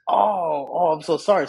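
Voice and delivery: retold sheepish apology; mortified apology. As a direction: apologize as if suddenly realizing the blunder. Acted horrified apology to prince.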